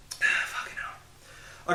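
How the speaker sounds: under-breath whisper of annoyance; whispered curse. Real, not acted. Vlogger fed up with his itchy nose, whispered curse. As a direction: breathe the swear out, exasperated.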